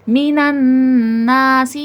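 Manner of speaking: very slow, measured chant; drawn-out tajweed chant; Quranic recitation. Shown as a demonstration. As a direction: recite slowly, giving each letter its due. Teacher recites the verse.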